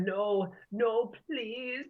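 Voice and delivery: high, drawn-out mock pleading; Cell begging. Cell cowering before Vegeta, begging for his life. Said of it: "begging for his life, going".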